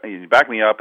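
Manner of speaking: ordinary talk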